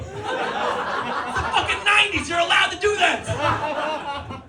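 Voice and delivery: high comic shouting rant; exasperated rant. Acted. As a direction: yell advice at the clueless neighbor.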